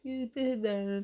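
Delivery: thanking God through laughter; laughing. Retold or imitated. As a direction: laugh through the words in joy. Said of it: "And I started laughing".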